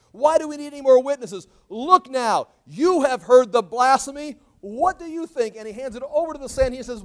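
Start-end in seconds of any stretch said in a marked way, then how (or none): 0.1-5.5 loud, high appeal to the court; playing outraged. High priest to the Sanhedrin, feigned outrage. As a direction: demand a verdict with theatrical force. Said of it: "he's just playing out the role".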